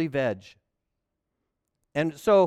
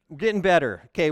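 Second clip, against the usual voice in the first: ordinary talk, nothing marked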